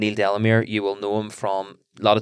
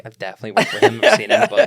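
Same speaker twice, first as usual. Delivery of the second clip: imitating guest; 12 semitones higher, 7 dB louder than usual. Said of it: "so you're probably like".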